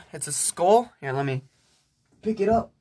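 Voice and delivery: ordinary talk, nothing marked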